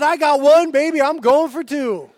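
put-on cocky excited kid. Preacher imitating his son eager for the tooth fairy, loud and gleeful. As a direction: crow it like a kid who's scheming for double presents.

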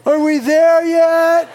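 put-on slow, high whiny kid voice; whining child. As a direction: drag it out like a bored kid. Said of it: "the quintessential one is".

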